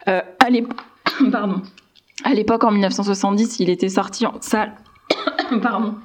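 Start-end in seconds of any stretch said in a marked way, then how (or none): 0.1-2.3 choking, words forced out. Real, not acted. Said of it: "Je m'étouffe". 5.1-5.6 coughing fit from choking. Real, not acted. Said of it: "Je m'étouffe".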